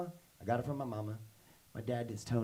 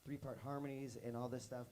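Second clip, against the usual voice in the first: ordinary talk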